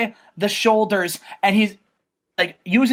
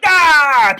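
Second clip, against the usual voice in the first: pumped-up yell; 6 semitones higher, 7 dB louder than usual. Retold or imitated. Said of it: "He's like".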